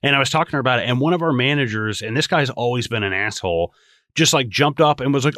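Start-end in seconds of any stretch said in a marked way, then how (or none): none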